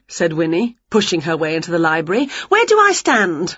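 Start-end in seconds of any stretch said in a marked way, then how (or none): 2.5-3.5 high, keen question; eager witch. A narrator's reading. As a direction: ask high, raring to join in. Winnie eager to join.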